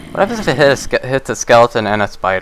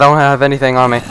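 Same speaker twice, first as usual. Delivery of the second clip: ordinary talk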